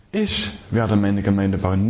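slow, low line opening hard on „Ich“; slow, weight on „Ich“; stress on ICH. Shown as a demonstration. Preacher telling church it is Jesus, no one else. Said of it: "niemand sonst".

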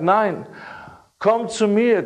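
ordinary talk, nothing marked

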